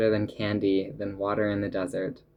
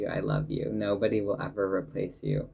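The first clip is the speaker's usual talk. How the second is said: ordinary talk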